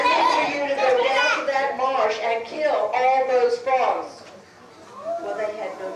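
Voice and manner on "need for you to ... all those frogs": loud, forceful order; commanding shout. Acted. Chief loudly ordering villagers to kill the frogs.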